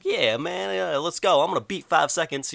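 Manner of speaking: high-pitched, eager impression; having fun. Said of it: "he's actually kinda having fun with it".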